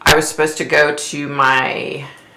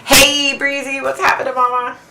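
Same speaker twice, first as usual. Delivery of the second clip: high cheery greeting; 10 semitones higher than usual. Real, not acted. Greeting viewers joining the live.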